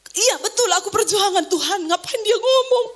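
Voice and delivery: high-pitched, incredulous protest; acted-out flustered complaint; exasperated reaction. Acts out exasperated reaction to testimony.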